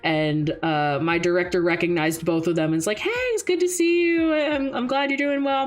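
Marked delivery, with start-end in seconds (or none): 3.0-5.6 imitated high, cheery hello; friendly greeting. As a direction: act out a delighted welcome, pitched up. Retold director greeting her friends.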